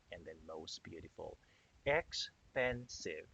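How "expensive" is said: "Expensive" slowly in three beats, ex, pen, sive; "Expensive" drawn out with gaps between its syllables; split into syllables. Shown as a demonstration. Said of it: "three syllables".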